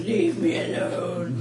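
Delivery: low rumbling dragon growl; a hint of a grumpy dragon. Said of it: "my dragon voice comes out of my unconscious mouth going".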